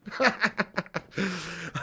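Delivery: helpless tickled laughter; real laugh. Real, not acted. Streamer cracked up by Kevin Webster name, real laughing. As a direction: laugh at your own silly impression. Tickled by his own impression.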